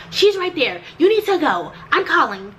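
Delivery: imitating the receptionist pointing and telling her to go; mimicking the receptionist. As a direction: wave her off impatiently. Receptionist voiced.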